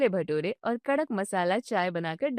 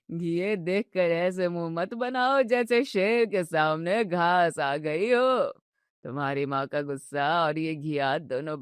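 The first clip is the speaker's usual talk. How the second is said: put-on slow, lower, drawn-out jokey old man; teasing old father-in-law; 5 semitones lower, slower than usual. Father teasing son and wife at dinner, slow and joking. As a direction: savour every jibe like a mischievous grandfather.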